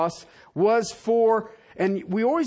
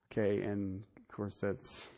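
normal talking voice

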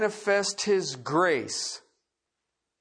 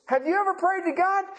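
excited; 10 semitones higher, faster than usual. Real, not acted.

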